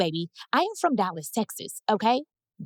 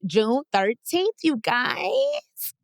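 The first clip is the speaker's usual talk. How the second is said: higher, drawn-out date announcement; excited announce; 4 semitones higher, much slower than usual. Real, not acted. Telling fans the show date, excited and drawn out. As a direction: sell the date with a rising lift.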